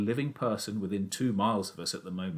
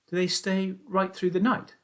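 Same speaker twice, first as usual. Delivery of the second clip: put-on young Danny, higher, doubtful; boy's voice; 11 semitones higher than usual. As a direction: object like a sceptical boy. Danny asks his father.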